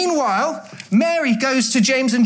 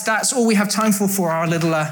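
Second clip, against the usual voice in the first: ordinary delivery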